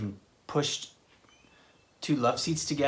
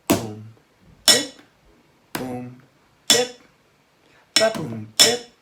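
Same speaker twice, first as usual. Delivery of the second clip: ba boom bip; vocal drum pattern; 2 semitones lower, 6 dB louder, slower than usual. Shown as a demonstration. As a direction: say the rhythm for kids to copy. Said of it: "start with our boom and then our bip".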